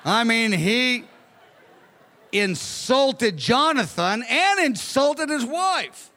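ordinary talk, nothing marked

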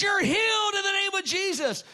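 loud, high preacher shout; proclaiming healing. Retold or imitated. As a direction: declare it to the whole hall.

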